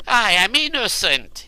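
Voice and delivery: high, loud pleading cry; cried out. A narrator's reading. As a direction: shout your innocence in panic. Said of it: "he cried".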